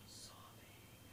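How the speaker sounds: very soft, breath-only word; hushed mock sob; whispered sobbing. Voices the sobbing child's state.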